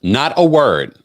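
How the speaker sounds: loud, punchy repeat; emphatic repeat. Retold or imitated. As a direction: hit it hard, incredulous. Repeating Dorit's line loudly.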